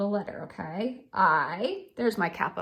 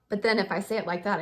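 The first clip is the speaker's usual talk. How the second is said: ordinary talk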